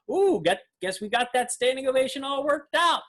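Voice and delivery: high, wry imitation of a magician brushing off the ovation; a light, amused impression of the showman; mock Mac King. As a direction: tease the room with a lifted, knowing voice. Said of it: "He's like".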